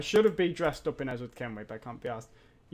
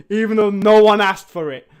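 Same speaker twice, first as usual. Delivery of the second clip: loud, higher indignant shout; angry rant; 4 semitones higher, 12 dB louder than usual. Real, not acted. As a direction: yell it in outrage. Rant at the game company.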